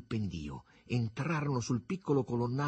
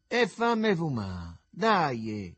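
raised voice, drawn-out call; shouted demand; 5 semitones higher, 6 dB louder, much slower than usual. A narrator's reading. Begalo asks his pal for a smoke, shouting. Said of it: "gridò il Begalo".

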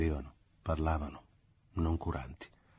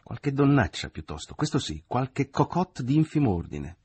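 scornful mother; 8 semitones higher, 4 dB louder than usual. Acted. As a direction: sneer it down at her. Mother sneers at a rival woman.

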